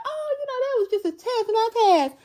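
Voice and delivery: high, airy mock nonchalance; mock breezy. Mocking smug believers who brush off the test. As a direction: brush it off like it was nothing.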